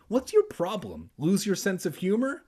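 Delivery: high, teasing acted questions; exclaiming challenge. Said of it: "Andy exclaimed".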